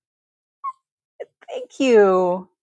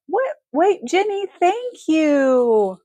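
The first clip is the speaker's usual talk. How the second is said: sudden high, delighted; real surprise at a gift; surprised thanks; 7 semitones higher, 4 dB louder, much slower than usual. Real, not acted. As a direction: catch the gift and light up. Surprised by viewer's super chat.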